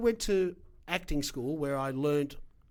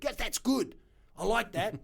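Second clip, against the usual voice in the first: high, excited acted reply; delighted approval; 8 semitones higher than usual. Friend hearing the lobby group name, excited approval. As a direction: pounce on the idea with glee.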